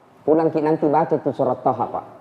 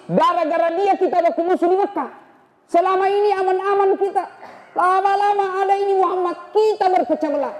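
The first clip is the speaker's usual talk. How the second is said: much higher, tense and insistent; fired-up Umar. Acted. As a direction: justify the killing with heat. Umar explains his plan to kill.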